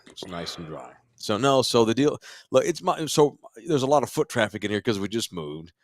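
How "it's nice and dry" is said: quiet satisfied echo; soft repeat. Shown as a demonstration. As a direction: repeat it softly to yourself.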